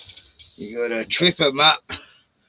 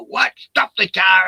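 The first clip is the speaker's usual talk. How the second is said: higher, louder, sudden outrage; indignant outburst; 6 semitones higher, 3 dB louder, slower than usual. Retold or imitated. Mate turning on the skinheads, pitched-up mock anger. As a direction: demand the car stop, affronted. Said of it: "and you go".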